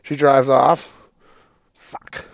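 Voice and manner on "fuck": breathless unvoiced fuck; whispered curse. Man left on the roadside, whispered curse. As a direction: mutter it to yourself, stranded.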